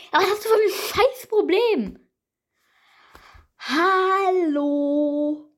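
high, loud angry outburst; angry yell. Real, not acted. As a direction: scream at the broken game. Raging at the frozen game.

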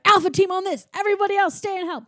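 high, brisk mock command; mock squad leader. Rats dividing up to chase the inspector, mock squad orders. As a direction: rattle off orders like a team leader.

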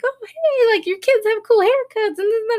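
high, bubbly nurse greeting; chirpy nurse. Retold or imitated. Nurses greeting the kids at the clinic, high and chirpy. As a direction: sing it out like a cheery nurse. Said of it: "The nurses obviously are like".

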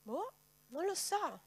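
slow, high shrugging doubt; doubtful shrug. Real, not acted. Preacher doubting the good news, slow shrug. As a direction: shrug it off, unsure.

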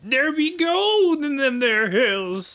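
high, slow hammed-up gold-rush prospector; old prospector voice. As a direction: drawl it out like a grizzled miner striking gold. Prospector cliché after gold discovered.

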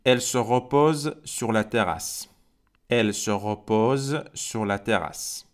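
slow, careful French for learners; slow exercise sentence read aloud; slow dictation reading. Shown as a demonstration. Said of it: "صرفاً براتون میخونم".

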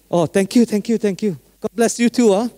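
high, happy thanks from the driver; grateful taxi driver. Retold or imitated. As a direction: gush thanks like a moved driver.

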